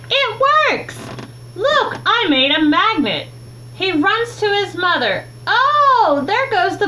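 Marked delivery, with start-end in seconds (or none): none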